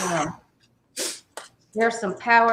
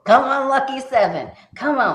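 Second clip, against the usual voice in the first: excited urging; 2 semitones higher, 8 dB louder than usual. Real, not acted. Urging a lucky roll.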